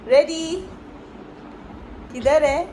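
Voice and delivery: loud, high playful where-is-he; playful seeking call. Real, not acted. Mother playing hide and seek with her baby, loud playful calls.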